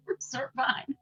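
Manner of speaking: ordinary delivery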